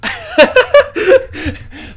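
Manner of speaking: laugh. Real, not acted. Laughs at brother's reply.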